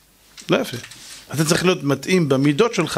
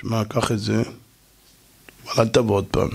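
grudging low giver; 9 semitones lower than usual. Retold or imitated. (נותן בחוסר חשק, נמוך ושקט)

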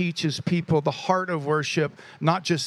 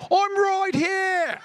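mock British accent, high long holler; very high, drawn-out British-accented shout; Caleb's accent; 14 semitones higher, much slower than usual. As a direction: call from the back in a put-on British accent. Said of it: "gave it that accent".

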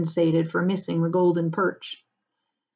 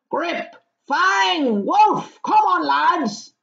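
high, loud call to the dogs; loud shouting; 11 semitones higher, 5 dB louder, much slower than usual. Acted. Farmer calling his guard dogs, loud shouting. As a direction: holler out, drawing the words long. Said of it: "a loud voice was heard shouting".

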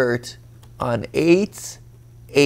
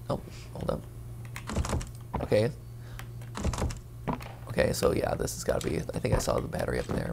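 hushed sneaking whisper; 3 semitones higher, 5 dB quieter than usual. Real, not acted. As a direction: whisper so nothing hears. Sneaking past monster, whispering.

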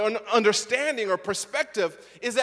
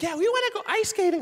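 put-on eager kids; 11 semitones higher, faster than usual. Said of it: "my kids were like".

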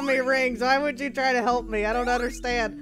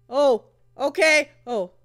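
ordinary delivery

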